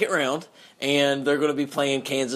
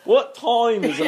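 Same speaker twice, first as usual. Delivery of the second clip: higher-pitched mock northern accent; 5 semitones higher, 4 dB louder than usual. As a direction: imitate a friend's northern accent, half laughing. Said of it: "very northern".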